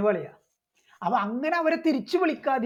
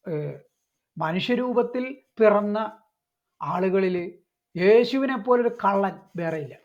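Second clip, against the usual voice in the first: ordinary talk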